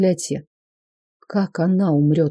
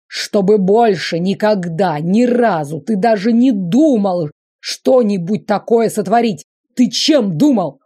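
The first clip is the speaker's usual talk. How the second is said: loud, raised furious scolding; angry scolding; 3 semitones higher, 4 dB louder than usual. Acted. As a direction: tear into him. Emperor scolds Lavrentin.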